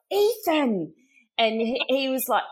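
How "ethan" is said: high, loud warning call of "Ethan"; scolding mum. Retold or imitated. Mother scolding her son in front of her friend, sharply. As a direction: call his name sharply in mock shock. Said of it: "And I was like".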